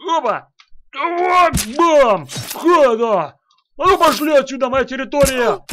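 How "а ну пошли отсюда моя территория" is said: bossy shout. Pretend. Character chasing others away.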